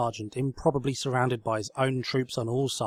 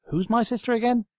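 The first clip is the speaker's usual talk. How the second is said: confused; 12 semitones higher, 5 dB louder than usual. Acted. Confused wardrobe asks.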